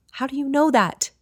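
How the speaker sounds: high, pressing exasperation; exasperated. A narrator's reading. Kate demanding answers from Sherry, exasperated. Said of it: "I ask, exasperated".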